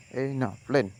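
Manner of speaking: ordinary delivery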